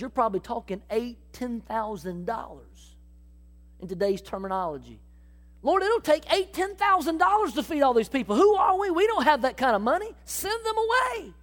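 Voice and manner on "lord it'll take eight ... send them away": panicked protest. Acted. Philip pleads with Jesus about feeding the crowd, rising panic. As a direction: pitch climbing high and anxious, ending in a high plea.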